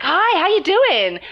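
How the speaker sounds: hammed-up bubbly waitress voice; mock chirpy American waitress. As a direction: sell the over-the-top friendly hello. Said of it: "the waitress was like".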